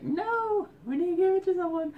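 high, loud mock wail; re-enacting a pleading yelp; acted protest. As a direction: protest in a squealing, over-the-top voice. Acted own protest about the cat.

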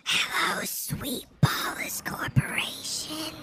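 soft whispered ASMR greeting; ASMR whisper for a viewer. Pretend. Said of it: "wants to listen to some A S M R".

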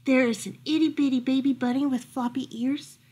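ordinary delivery

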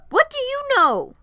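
very high, loud outburst; snapping yell. A narrator's reading. Varian cuts Piper off mid-sentence, yelling. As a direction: snap it out as a yell. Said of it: "scared by the yelling".

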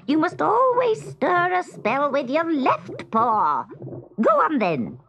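high, exasperated witch scolding; screeching witch. A narrator's reading. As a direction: screech your orders at a hopeless pupil. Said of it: "screeched the witch".

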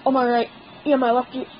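normal talking voice

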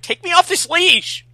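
high, loud whiny whine on "Take me off this leash!"; whiny demand. Shown as a demonstration. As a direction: whine it like a spoiled kid. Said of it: "do it more like whiny".

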